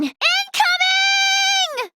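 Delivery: high, long-drawn shout; battle call. Acted. Flying in to the rescue, high battle call. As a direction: holler it out, stretching the word. Calls out while charging in.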